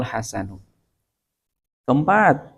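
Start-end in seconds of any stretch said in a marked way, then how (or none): none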